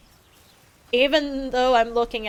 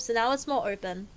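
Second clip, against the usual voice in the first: normal talking voice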